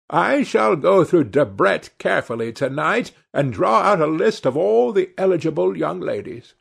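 Lady Narborough. A narrator's reading.

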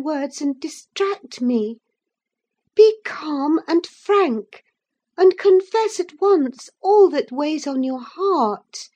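high, anxious coaxing plea; moved and alarmed. A narrator's reading. Catherine gently pressing Linton to confess. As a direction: coax the truth out of him tenderly. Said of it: "thoroughly moved and alarmed".

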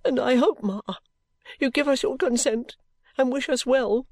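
high-pitched daughterly appeal; acted timid, weepy request; tearful pleading. A narrator's reading. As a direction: plead with mother through tears.

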